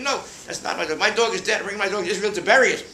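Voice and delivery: high, loud punchline demand; hammed-up offended lady; indignant old lady. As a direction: deliver her complaint big. Mrs Goldberg's punchline.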